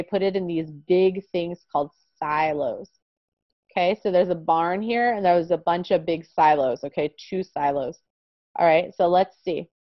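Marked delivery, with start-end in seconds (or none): none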